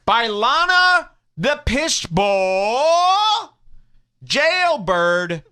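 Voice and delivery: slow drawn-out ring announcer build; announcer callout. Acted. Host hypes a mocking nickname, slow announcer style. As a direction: boom out the mock title like a ring announcer.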